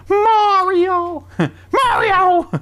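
very high, loud Mario call. Pretend. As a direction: shout it like cartoon Mario. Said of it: "Do your best".

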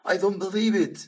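loud, high disbelief; a freed lion astonished, loud and high; amazed. Acted. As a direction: burst out in astonishment.

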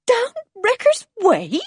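high, slow, incredulous question; aghast, stretched-out question; shocked cry. A narrator's reading. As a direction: echo it in high-pitched disbelief. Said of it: "cried Missus Penruthlin".

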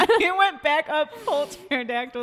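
high laughing slowly. Real, not acted. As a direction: laugh through the words. Laughing at own hand.

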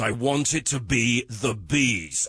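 slow, grandly theatrical; mock director. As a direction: ham it up like a pompous director. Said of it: "you can imagine him saying".

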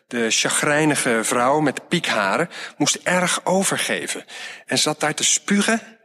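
ordinary delivery, nothing marked

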